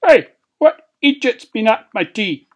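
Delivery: loud, high dwarf complaint; indignant outburst. Acted. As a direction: demand crossly who did it. Dwarf finds his tea eaten.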